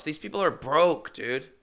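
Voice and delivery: ordinary talk, nothing marked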